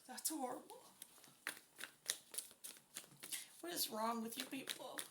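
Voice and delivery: appalled disbelief, barely voiced; real upset, holding back; disgusted and heartbroken. Real, not acted. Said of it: "I am so disgusted right now".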